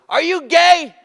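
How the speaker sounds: high, loud jeering shout; heckler shout. Retold or imitated. Heckler in Fort McMurray yelling at Mike, high and loud. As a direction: yell it from the back.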